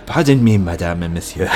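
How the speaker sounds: lightly voiced low waiter. Waiter politely interrupting two diners, low waiter voice. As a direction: play a waiter, dropping low at the end.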